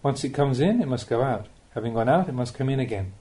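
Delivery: ordinary delivery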